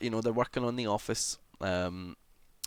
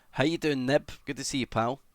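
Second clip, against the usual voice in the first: ordinary talk, nothing marked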